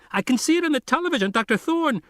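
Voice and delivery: frantic fast high radio voice; hysterical kid. Acted. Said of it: "He sounds completely hysterical".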